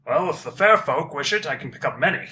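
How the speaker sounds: loud, booming lumbering giant; dopey cartoon bellow. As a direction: bellow it like a big friendly giant. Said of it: "He says".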